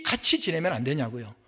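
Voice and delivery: ordinary delivery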